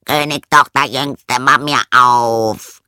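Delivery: loud, slow frog call at the door. Acted. Frog knocking at the castle door, loud and slow. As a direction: drag out mach mir auf loudly. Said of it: "klopfte es an die Tür und rief".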